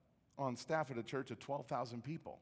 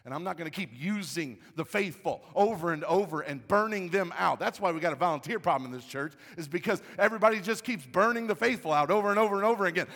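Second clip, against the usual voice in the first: loud, raised voice building to a shouted Amen; impassioned tirade; 6 semitones higher, 14 dB louder than usual. Retold or imitated. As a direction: imitate a wife's fed-up rant, preached at full force. Wife's fiery tirade in retold quarrel.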